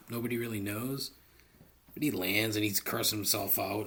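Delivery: usual speaking voice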